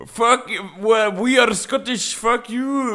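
high-pitched mock Scottish defiance; attempted Scottish accent. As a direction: act a defiant Scot with a bad Scottish accent. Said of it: "use the Scottish accent then".

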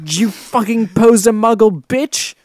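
loud, high, stammering accusation; hammed-up furious shouting; angry shout. A narrator's reading. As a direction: splutter it in melodramatic outrage. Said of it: "he shouted".

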